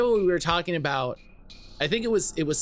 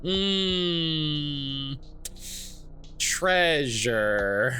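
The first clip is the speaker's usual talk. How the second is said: tempted hmm; 5 semitones lower, much slower than usual. Retold or imitated. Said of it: "Even Faye's like".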